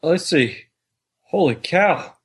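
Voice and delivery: ordinary talk, nothing marked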